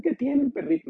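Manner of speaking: voz mucho más aguda y más fuerte; gente compasiva imitada. Retold or imitated. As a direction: burla a la gente que se apiada. Gente preocupada por el perro. (imitación aguda de gente preocupada)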